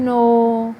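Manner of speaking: NO drawn out, sliding down low; falling pitch. Shown as a demonstration. Teacher shows students how pitch falls, falling NO. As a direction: let NO sink slowly downward in pitch. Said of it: "अब fall हुई और मेरी pitch नीचे गिरी".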